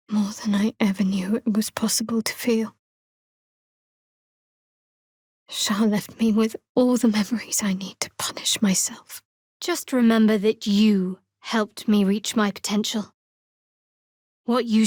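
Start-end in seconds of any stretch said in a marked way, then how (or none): 0.1-9.2 hushed unvoiced whisper; whispered brooding confession. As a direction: confide it in a bare breath.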